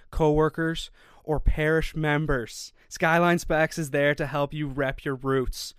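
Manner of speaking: loud, polished podcast ad read; sponsor ad read. Real, not acted. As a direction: read the sponsor spot, projecting well. Reads sponsor ad.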